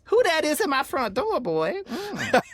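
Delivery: high, loud, slow mock sassy woman at the door. Guest doing voices, imitating a sassy woman answering the door. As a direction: play a sassy lady calling toward the door.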